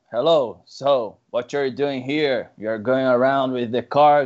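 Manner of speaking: policial em inglês, mais grave e lento; policial americano. Retold or imitated. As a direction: imite o policial gringo, devagar. (policial gringo interrogando o motorista, voz mais grave e lenta)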